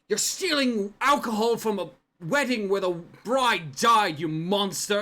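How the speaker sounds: loud accusing shout; wedding guest shouting at a drunk; angry yell. Acted. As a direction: yell at the thief. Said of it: "you hear them yell".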